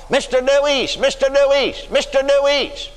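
high calling out of a name. Retold or imitated. Someone hollering his name.

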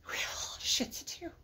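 breathy mock despair whisper; whispered wail. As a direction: whimper it under your breath. Repeats whispered with cry.

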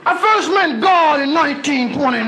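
high, slow preacher telling his calling. Retold or imitated. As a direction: preach it like an old-time reverend.

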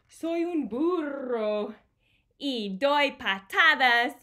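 slow, loud, heavy reading; slow booming storybook line; stomping emphasis. Acted. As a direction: pound out each word like big kicks. Heavy donkey kicking line.

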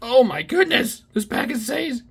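high and loud amazement; overjoyed. Real, not acted. Telling viewers his pack is insane, overjoyed. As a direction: squeal it out, you can't believe it. Another big pull.

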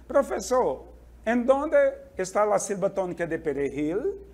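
ordinary talk, nothing marked

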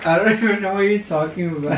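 laughing nervously through the words. Real, not acted. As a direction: giggle while you deny it. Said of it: "Your little nervous laugh".

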